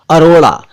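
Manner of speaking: अरोड़ा said with a tongue-flicked ड़ in the middle; अरोड़ा, flap the ड़ sharply; clear retroflex flap. Shown as a demonstration. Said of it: "जैसे मैंने बोला".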